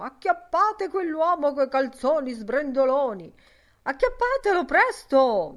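loud, high-pitched holler; shouted hail. Acted. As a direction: shout it like raising a hue and cry. Hailing shout across to men.

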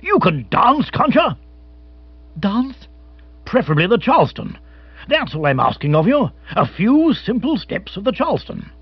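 put-on a pompous old adventurer struck by an idea; lightly voiced hearty captain; excitable old captain. A narrator's reading. Captain Bigger urging Bill.

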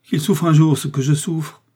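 dying man's loud imprecation; agonised curse. A narrator's reading. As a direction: curse him through your pain, voice high. Dying man curses in agony.

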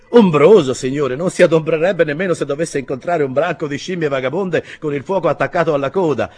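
loud, high, emphatic protest; scoffing stableman. A narrator's reading. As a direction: dismiss the doubt at full voice. (stalliere che protesta a gran voce)

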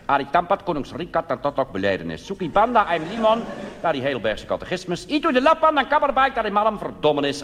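high, loud preacher reading Malay words with Dutch bits; mock Malay sermon. Dominee preaching to his Indonesian parish, in mock Malay. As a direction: ham up a sermon in garbled Malay. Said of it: "het wekelijkse Maleise woordje".

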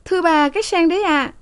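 high, polite maid's voice; respectful young maid. A narrator's reading. Maid politely answers her mistress, high voice. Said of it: "Lan thưa".

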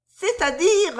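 sursaut aigu; sursauter, la voix monte; tressaillir. A narrator's reading. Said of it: "en tressaillant". (Susan tressaille, voix aiguë)

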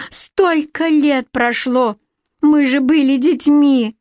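high, drawn-out appeal; pleading. A narrator's reading. Queen reminds rival of childhood, pleading. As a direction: plead urgently, pitch lifted. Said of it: "взмолилась Шапочка".

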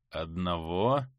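low, hollow voice from beyond the grave; sepulchral voice. Acted. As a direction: let it drop low and hollow like a ghost. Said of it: "загробным голосом произнёс шаман".

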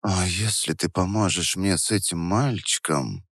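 soft seductive whisper. Acted. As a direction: whisper it into his ear. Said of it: "продолжала Вера шёпотом".

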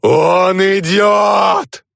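very high, slow drawn shriek. A narrator's reading. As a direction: shriek a warning from outside. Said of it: "завизжала с улицы Свана".

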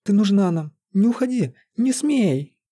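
high, acted begging; desperate pleading. A narrator's reading. Sorcerer begs dying Лиза to stay, pleading. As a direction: plead with pitch climbing high. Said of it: "умоляюще прошептал он".

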